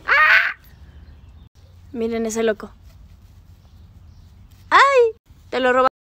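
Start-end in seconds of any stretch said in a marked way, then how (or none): 0.1-0.5 short high loud scream; sudden high "ah!"; pained yelp. Real, not acted. Woman to her biting dog, pained yelp. 4.7-5.2 high, loud "ay!"; sharp startled cry of pain; pained yelp. Real, not acted. Woman nipped by her dog, pained yelp.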